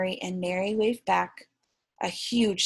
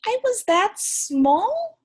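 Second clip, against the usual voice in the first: much higher, slow amazed question; surprised question; 12 semitones higher, 5 dB louder, slower than usual. Acted. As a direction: marvel at how small you were. Pip asks surprised.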